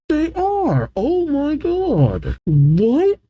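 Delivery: high-pitched disbelief; real astonishment. Real, not acted. Reader reacting to a rainbow bridge, high stunned disbelief. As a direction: gasp it out high, stretched in amazement.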